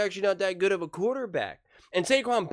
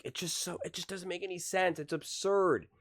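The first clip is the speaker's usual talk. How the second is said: ordinary talk, nothing marked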